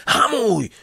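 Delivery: piercing high scream; yell. A narrator's reading. Assistant screaming at the driver, high and loud. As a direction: scream it in panic. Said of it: "wrzasnął pomocnik".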